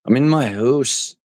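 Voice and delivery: high, slow mock Canadian accent; failed attempt at a Canadian accent; bad Canadian accent. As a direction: stretch "house" toward "hoose" in fake Canadian. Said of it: "I can't do a Canadian accent".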